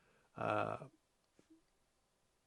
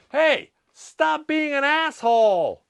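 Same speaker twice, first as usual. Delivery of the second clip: much higher, louder, slow, drawn-out HEY; final mock yell; 11 dB louder, slower than usual. The chosen English line for the tree shaker, a slow hammed-up yell. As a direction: stretch the call out like a playful shout. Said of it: "それが一番いいかもね".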